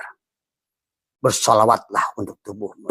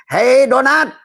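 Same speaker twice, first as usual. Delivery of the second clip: calling out; 14 semitones higher, 7 dB louder, slower than usual. Acted. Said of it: "addressing donut in character".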